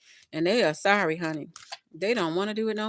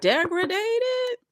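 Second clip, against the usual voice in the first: squealed word; 12 semitones higher than usual. Real, not acted. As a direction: squeal it out in mock despair. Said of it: "despondent, degraded".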